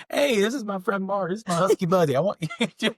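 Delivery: giggling while introducing a friend; laughing mock introduction.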